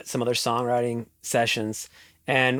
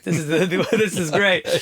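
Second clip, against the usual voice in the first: laughing with delight; 5 semitones higher than usual. Retold or imitated. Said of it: "I'm like".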